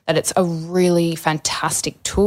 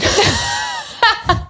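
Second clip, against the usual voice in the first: high burst of laughter; sudden high-pitched real laugh. Real, not acted. Guest laughs at forgetting the question, high burst of laughter.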